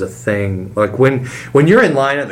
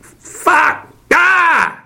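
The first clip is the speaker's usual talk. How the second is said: high strained voice; frustrated announcer attempt. Shown as a demonstration. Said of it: "It's different when I'm standing in front of the mic".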